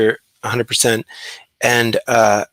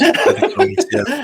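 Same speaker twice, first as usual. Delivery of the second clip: much higher, laughing through the words; laughing squeal; slower than usual. Real, not acted. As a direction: crack up mid-phrase. Laughing reaction to wife story.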